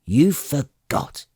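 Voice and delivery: put-on an old man scolding his forgetful heir; drawn-out reproachful snap; snapped. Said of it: "snapped Beeblebrox the fourth".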